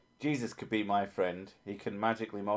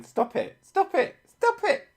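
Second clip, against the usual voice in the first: high-pitched protest, rising higher and louder each time; mounting irate squeal; 6 dB louder than usual. Retold or imitated. Retelling being hit by partner, escalating high-pitched irritation. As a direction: get more irate and squeaky with each repeat. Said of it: "Getting more and more fucking irate, and obviously more and more high-pitched".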